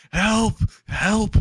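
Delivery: high-pitched yelps for help; short, high, hammed-up cries; mock cries for help. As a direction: act out calling for help while falling. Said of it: "as he's falling down".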